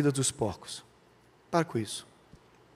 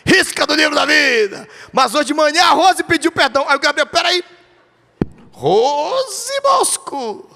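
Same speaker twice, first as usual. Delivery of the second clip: high, loud, fervent preaching; rising pulpit fervor; full-force preaching; 10 semitones higher, 5 dB louder than usual. Acted. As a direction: preach it out to the whole church.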